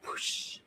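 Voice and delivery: breathy whoosh of a ball shot; whispered whoosh. Retold or imitated. Medium imitating the aunt's basketball shot, whispered whoosh. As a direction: blow it out like a ball swishing the net.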